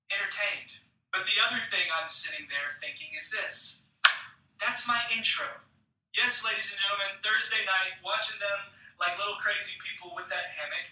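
ordinary delivery

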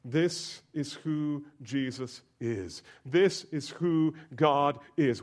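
ordinary talk

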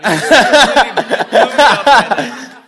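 loud laughing shout, slowing at the end; loud laughter. Real, not acted. Preacher boy being teased by the host, shouting with laughter.

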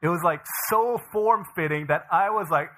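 normal talking voice

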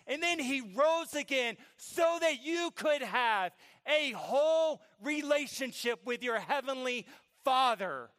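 high, shouted, drawn-out exhortation; full-force preaching. Real, not acted. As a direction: thunder it slowly at the congregation.

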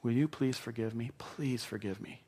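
soft, slowing plea for forgiveness; mean every word, gently; tender apology to someone you hurt; sincere plea. Shown as a demonstration. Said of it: "look somebody in the eye and say".

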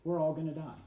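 usual speaking voice

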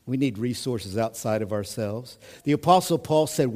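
normal talking voice

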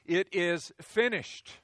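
loud, drawn-out proclamation; slow, ringing cry of completion; cried out. Acted. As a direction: call it out from the cross. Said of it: "he cried out".

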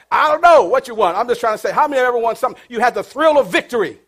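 normal talking voice

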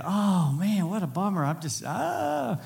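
hammed-up bummer moan; mock dejected groan. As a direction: play up the letdown, drawn out. Dejected inner thought at advice.

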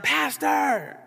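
high-pitched imitated call, drawn out; retold yell. Someone yelling for the pastor from a car, imitated high call. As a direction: mimic someone hollering to get attention. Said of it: "I just hear somebody yelling".

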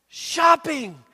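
high, loud, gleeful cry about shopping; giddy shopper. Acted. Mocking a sad shopper turning giddy, high and loud. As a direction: play the giddy shopper who just found the cure.

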